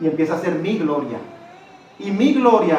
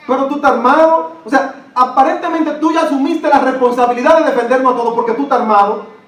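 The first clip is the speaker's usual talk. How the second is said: higher, louder fervent preaching; preaching at full force; 7 semitones higher, 5 dB louder than usual. Acted. Pastor preaching to the congregation, high and loud. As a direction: proclaim it from the pulpit.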